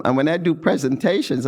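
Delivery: ordinary talk, nothing marked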